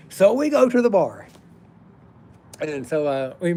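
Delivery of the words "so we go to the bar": much higher and louder, thrown out; exasperated exclamation. Real, not acted. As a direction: blurt it out in mock defeat. Punchline exclamation.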